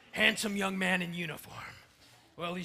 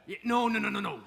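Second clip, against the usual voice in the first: ordinary talk, nothing marked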